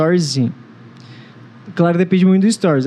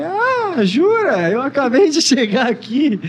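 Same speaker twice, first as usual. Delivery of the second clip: fake surprise; 7 semitones higher, much slower than usual. Fakes surprise in retold chat.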